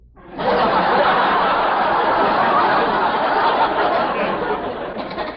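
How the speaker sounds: loud hearty family laughter; laughing. Acted. Family laughing at father's tirade, loud hearty laughter. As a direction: roar with laughter. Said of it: "All right, laugh".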